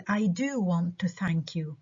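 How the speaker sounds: slow, with "do" emphasised; stress on DO. Shown as a demonstration. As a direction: lean on "do" to insist. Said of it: "فأنا بدي أأكد".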